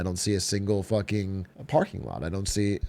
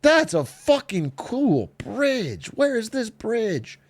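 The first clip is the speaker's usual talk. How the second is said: excited gushing; 10 semitones higher, slower than usual. Real, not acted. Gushing at bridge photo.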